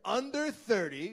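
high, slow pompous Charles. Retold or imitated. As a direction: draw it out with grandeur. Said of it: "You got your Charles voice on again".

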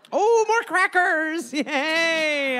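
high, slow and thrilled over crackers; gleeful cracker delight. Acted.

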